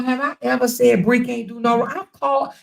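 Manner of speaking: ordinary talk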